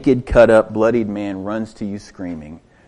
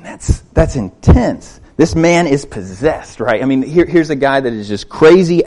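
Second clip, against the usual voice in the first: usual speaking voice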